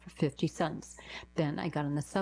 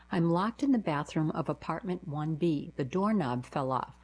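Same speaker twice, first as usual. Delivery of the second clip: plain reading voice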